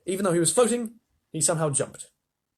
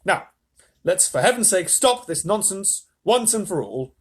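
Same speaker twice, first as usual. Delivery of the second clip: stern; 6 semitones higher, 5 dB louder than usual. A narrator's reading. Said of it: "Mister Wonka said sternly".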